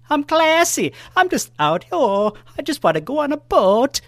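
high, mixed-up put-on accent; Cornish hen voice. Hen voice dreams of boating with Roquan, high and silly. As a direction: squeak like a posh little hen. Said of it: "I just mixed up the accents".

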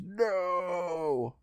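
high, long drawn-out wail without extra volume; mock scream. Host voicing the doctor turning to stone, mock scream. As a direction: imitate a doomed villain's held cry. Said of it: "and he screams".

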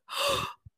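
high startled intake of breath; waking girl gasping in realization; mock shocked gasp. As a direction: gasp as if suddenly remembering. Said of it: "And she was like".

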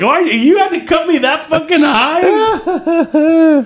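high, slow, laughing disbelief; laughing mock shock. Seeing the scar after the cast came off, high laughing disbelief. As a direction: stretch it out high through a laugh.

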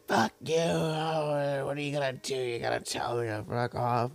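put-on high, slurred drunk woman; drunk slurring. Homeless woman mouthing off at the clerk, slurred drunk. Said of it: "she sounded drunk".

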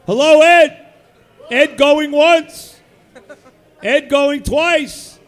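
loud, slow auctioneer calling out; going once, going twice, drawn out loud; hyped giveaway announcement. Real, not acted. Auctioneer-style calling of absent winner.

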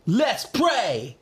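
high, drawn-out hype yell; high-pitched catchphrase holler; hype catchphrase shout. Acted. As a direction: belt it out like a hype man.

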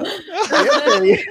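squeaky high-pitched laugh through the words; high laughing squeal. Real, not acted.

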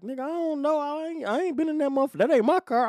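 retold protest, very high-pitched; panicked denial. Parolee denying drugs to officer, panicked denial.

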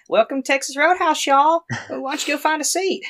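put-on very high teenage girl voice; perky young girl greeting customers at her first job; squeaky seventeen-year-old restaurant greeter; teenage hostess. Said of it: "blonde girl, definitely, like, seventeen".

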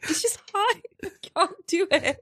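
hammed-up helpless high Australian; Nicole Kidman. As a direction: plead in an actress impression. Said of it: "I've also got Nicole Kidman".

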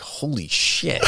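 slow, breathless disbelief; awed. Retold or imitated. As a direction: let it out slowly in shock. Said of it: "I'm like".

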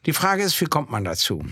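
ordinary talk

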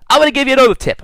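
bright, loud lift into a new tip; excited announcement. Real, not acted. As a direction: pitch it up with energy. Bursting into next point.

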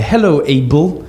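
slow, crisp "Hello, Abel", every syllable of "Abel" spelled out; enunciated greeting. Retold or imitated. Boy greeting his stepfather by first name, slow pointed enunciation. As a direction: lay each sound of "Abel" out slowly and pointedly. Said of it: "I made sure I enunciated it".

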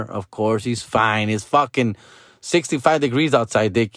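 ordinary talk

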